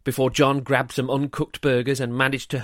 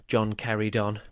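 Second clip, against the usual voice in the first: ordinary delivery, nothing marked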